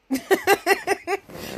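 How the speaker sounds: high run of quick laughter; real giggling laugh. Real, not acted. Vlogger laughing after using her cousin as example, giggling.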